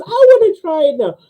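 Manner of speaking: high, pleading falsetto; eager joke nun. Acted. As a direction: beg for a turn in a squeaky voice.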